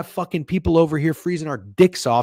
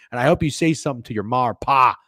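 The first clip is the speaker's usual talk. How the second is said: ordinary talk, nothing marked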